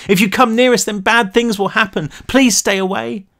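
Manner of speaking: high, tearful warning; sobbing. A narrator's reading. Daisy warning the police, high tearful plea. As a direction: plead through tears.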